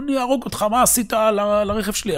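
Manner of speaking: high, furious yelling at a fool; angry shout. Acted. Angry driver yells at the man who hit his car. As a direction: scream threats over the wrecked car.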